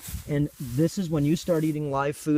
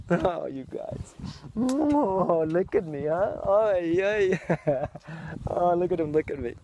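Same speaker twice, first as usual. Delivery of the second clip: high, delighted cooing; 5 semitones higher, slower than usual. Real, not acted. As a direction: coo at the horse with glee. Cooing to horses.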